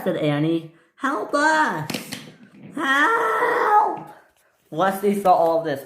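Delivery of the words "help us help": very high, drawn-out wail; cry for help. Acted. Dangling coaches calling to be rescued, cry for help. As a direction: squeal it out in acted panic.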